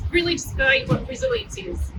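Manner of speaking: usual speaking voice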